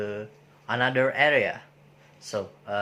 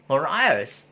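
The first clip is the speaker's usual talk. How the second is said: Northern Irish said clipped as "Nor Ice" in a Northern Irish accent; 3 semitones higher, 3 dB louder, faster than usual. Shown as a demonstration. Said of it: "in their accent, they will say it".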